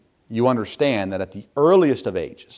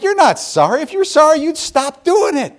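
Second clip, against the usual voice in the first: loud, high exasperated scolding; high, raised-voice telling-off; fed up; 6 dB louder than usual. Retold or imitated. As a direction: let them have it like a frustrated parent. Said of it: "I get fed up with it".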